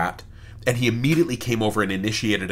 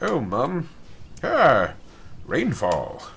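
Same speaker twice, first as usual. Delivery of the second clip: awkward robot butler making small talk; hammed-up fumbling flirt with a butler accent; Codsworth; 5 semitones higher, much slower than usual. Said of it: "trying to chat me up".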